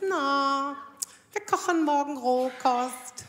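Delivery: langsam, etwas affektiert gesprochen; gezierte Freundin. Retold or imitated. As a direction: ahm eine stolze Freundin nach. Freundin von Sven zitiert. (Svens Freundin, leicht affektiert und langsam)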